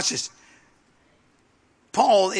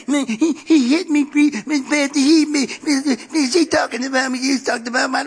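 put-on high, loud, babyish tattling; mock a bawling baby telling on someone; 7 semitones higher, 4 dB louder than usual. Preacher mocking baby Christians complaining, in a whiny baby voice.

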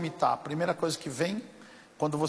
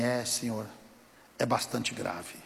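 low, subdued, softer voice; lightly voiced sombre bystander; grave verdict; 6 semitones lower, 7 dB quieter than usual. Rescuer breaking bad news to the injured man, gravely.

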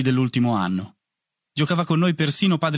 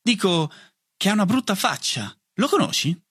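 higher-pitched swagger; cocky bravado; 7 semitones higher than usual. A narrator's reading. Boy recovering his swagger before Bonnet, high cocky tone. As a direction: put on a show of boldness. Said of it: "riprendere la mia aria più spavalda".

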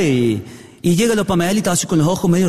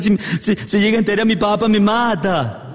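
mock tearful wail; 4 semitones higher than usual. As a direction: whine helplessly like a hammed-up sobbing daughter. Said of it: "se largó a llorar a los gritos". (lloriqueo exagerado, agudo y lento)

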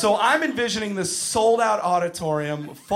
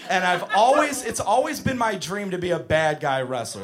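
ordinary talk, nothing marked

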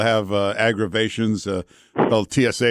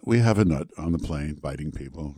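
low, understated pilot impression; calm pilot; 7 semitones lower, 4 dB quieter than usual. Host mimicking a pilot reporting trouble, calm. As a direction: play an unruffled pilot on the radio, lower. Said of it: "that's how they talk, pilots".

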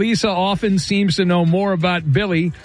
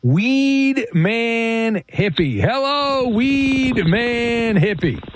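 stretched, higher-pitched ring-announcer welcome; drawn-out announcer introduction; 4 semitones higher, much slower than usual. Real, not acted. Host welcoming a controversial caller to the show, drawn-out announcer call. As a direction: draw out each word like a big introduction.

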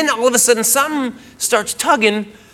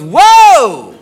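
loud, higher, drawn-out whoa; startled whoa; 4 semitones higher, 8 dB louder than usual. Retold or imitated. Girlfriend halting boyfriend putting on new underwear, startled. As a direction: imitate her alarmed stop-him cry.

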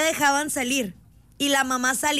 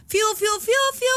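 high-pitched spoken "fiu" whistle calls; imitated whistle; 7 semitones higher than usual. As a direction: fake a mother's whistle with the voice. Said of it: "me chiflaba". (chiflido imitado con la voz, agudo)

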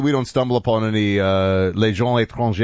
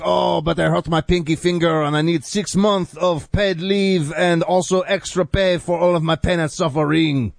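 whiny French complainer, pitched higher; French accent; 6 semitones higher than usual. Acted. Co-host mocking an injured French cop named Francois, exaggerated French accent. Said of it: "like a guy named Francois is like".

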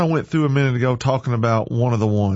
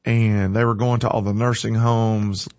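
ordinary talk

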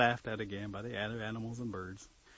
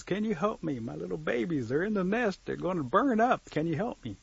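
pleading mother bird, pitched higher; higher, anxious storybook plea; desperate; 6 semitones higher than usual. Acted. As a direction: beg urgently for help, mildly acted.

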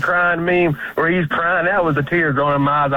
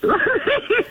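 squealing high-pitched laugh; helpless high giggle. Real, not acted.

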